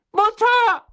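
very high, loud, desperate scream. A narrator's reading. Boy in chains calls to his mother, screaming. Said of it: "schrie der Junge".

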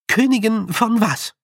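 put-on high, loud girl's question; high-pitched, pointed query; questioning young girl. A narrator's reading. Tiffany questions the clansman.

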